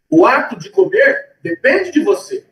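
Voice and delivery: ordinary talk, nothing marked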